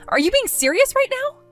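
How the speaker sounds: can't believe what she hears; shocked disbelief. Acted.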